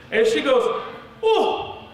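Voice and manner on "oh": put-on high, drawn-out excited Chinese-accented lady; squeal-pitched Chinese accent, overjoyed; thick Chinese accent. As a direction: put on a thrilled Chinese examiner. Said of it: "she had a really thick Chinese accent".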